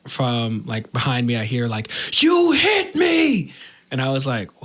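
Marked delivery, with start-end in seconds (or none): 2.2-3.5 sharp high yell of a startled cop; retold shout. Cop yelling from behind the car.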